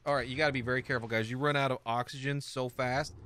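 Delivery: ordinary delivery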